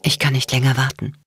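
mildly stifled urgency; choked voice. A narrator's reading. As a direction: say it with a slight catch. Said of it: "sagte er mit erstickter Stimme".

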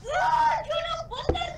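high-pitched screaming outburst; piercing, over-excited yell; very loud. Real, not acted. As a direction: shriek it at the top of your voice. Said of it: "[name], you're so loud".

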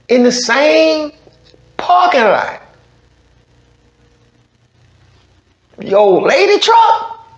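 high, slow incredulous mockery; mocking disbelief. Real, not acted. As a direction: drag it out, scoffing at the dad. Mocking reaction to the getaway.

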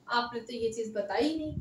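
ordinary talk

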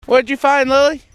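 high-pitched doggy talk. Real, not acted. Asking the dog about its find.

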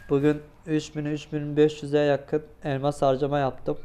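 loud, upbeat opening to the viewers; vlog intro. Acted. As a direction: hype up the start of the video. (yüksek sesli, enerjik vlog girişi)

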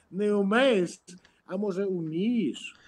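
ordinary talk, nothing marked

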